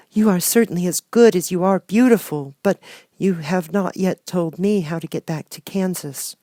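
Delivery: put-on high, earnest young girl's voice; a small girl longing for home; little girl Dorothy. Dorothy telling the good witch she wants home, girlish and earnest.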